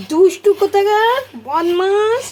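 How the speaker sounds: high, loud mock scolding grandmother; scolding grandma. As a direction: tell off a naughty child. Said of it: "ঠাম্মা বলে".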